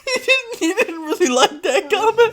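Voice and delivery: words squeezed out between high giggles; laughing through words. Real, not acted. Telling the co-host the reply while crying with laughter. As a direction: try to talk while laughing helplessly. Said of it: "I'm crying so much right now".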